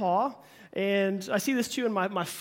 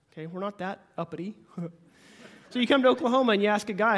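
ordinary talk, nothing marked

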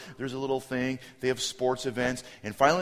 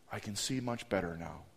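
soft, lower relieved voice; sudden calm; 5 semitones lower, 11 dB quieter than usual. Retold or imitated. Kid holding counselor's hand, suddenly calm and soft. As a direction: drop to a quiet, settled murmur.

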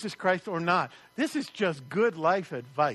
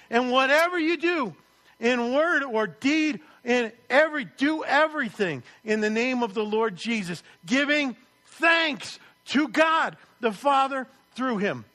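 scripture reading; 4 semitones higher, 5 dB louder than usual. Acted. Preacher reading the verse aloud to the congregation, proclaiming.

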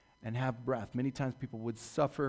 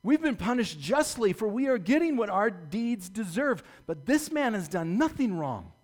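higher, louder scolding; stern rebuke; 7 semitones higher, 5 dB louder than usual. Acted. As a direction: put the other thief in his place. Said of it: "the other criminal rebuked him".

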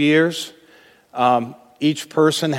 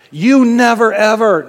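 much higher, louder blaming voice; accusing cry; 8 semitones higher, 8 dB louder than usual. Acted. Spouse in an argument, hurling a sweeping charge. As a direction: stretch out never, ever with blame.

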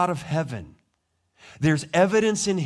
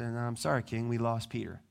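softer, sheepish admission; sheepish soldiers; 6 semitones lower, 9 dB quieter than usual. Acted. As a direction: confess the loss timidly. Soldiers confess to Herod.

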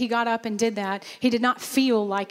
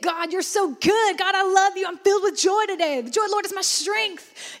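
high, bright-voiced exultant praise; joyful praise; 8 semitones higher than usual. Preacher voicing a happy believer praising God, excited. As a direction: overflow with joy to God. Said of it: "you see that".

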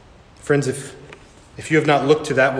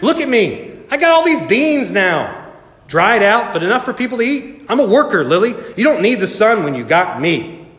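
boastful Bean Plant; 8 semitones higher than usual. A narrator's reading. Bean Plant telling Lily it can replace the sun, boastful. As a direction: show off proudly in a raised voice.

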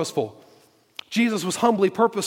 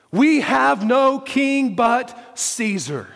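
slow, raised, spaced-out declaration; emphatic proclamation; 6 semitones higher, 3 dB louder, much slower than usual. Acted. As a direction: hammer each word, pause before the name. Preacher repeats the priests' declaration.

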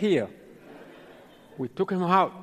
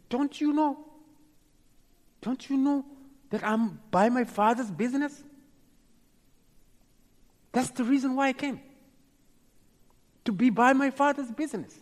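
high-pitched, insistent questioning; retold in a raised, earnest voice; young Jesus answering; 8 semitones higher than usual. Young Jesus to parents searching for him, high earnest protest.

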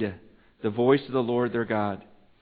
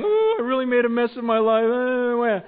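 mock crying; 8 semitones higher, 3 dB louder than usual. Preacher mimicking a churchgoer's tearful regret, mock crying. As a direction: imitate a weepy confession. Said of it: "coming today and crying".

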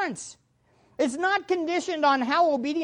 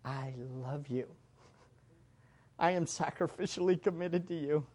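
put-on soft, low, gentle words to his kids; tender fatherly talk; 9 semitones lower, 13 dB quieter than usual. Preacher to congregation, quoting what he tells his kids: soft and tender. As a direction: hush it down like a father reassuring his children. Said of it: "I'll say".

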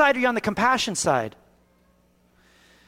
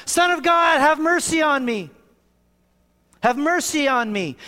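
crying out; 6 semitones higher than usual. Retold or imitated. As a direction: call out over a crowd, begging. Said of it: "he cries out".

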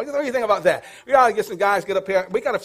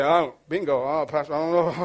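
low, mock-doubtful mumbling objection; imitated reluctant congregant; 6 semitones lower, 3 dB quieter than usual. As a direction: voice a hesitant churchgoer, lower and softer. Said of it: "You say".

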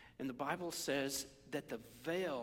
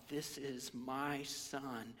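tender love; 2 semitones lower, 5 dB quieter than usual. Acted. Preacher voices God loving his son, tender and hushed.

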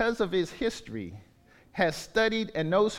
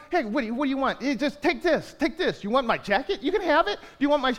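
higher, eager, generous mock voice; mock eager giver; 6 semitones higher, faster than usual. Pastor imitating a cheerful giver, eagerly high. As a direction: play an overjoyed giver pushing gifts. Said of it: "He just loves someone who's like".

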